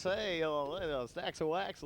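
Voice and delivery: mock disc jockey. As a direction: parody a cheesy radio DJ. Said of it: "change their voice and talk like this".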